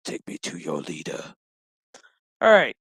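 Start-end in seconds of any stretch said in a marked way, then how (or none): 0.1-1.3 very low, soft voice; deep, hushed parody line; mock solemn demand. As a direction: drop way down for a hammed-up demand. Acted line for Picard.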